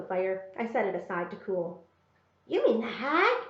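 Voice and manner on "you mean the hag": a touch of astonishment in a lightly voiced boy's question; astonished. Little brother learns the glue is for Kate, mildly astonished. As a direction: let it rise, mildly taken aback.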